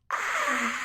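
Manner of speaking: high open "ah" demo. Shown as a demonstration. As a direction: pitch the "ah" way up. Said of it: "I call it".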